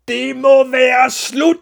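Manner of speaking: very high, slow, full-force yell; shout. A narrator's reading. Father puts an end to it, furious shouting. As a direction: bellow each word drawn out. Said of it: "råbte faren".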